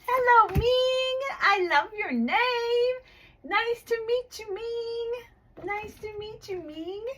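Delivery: put-on high, bright, sing-song greeting to a small child; bright teacher voice. Online teacher greeting her young student, high and sing-song.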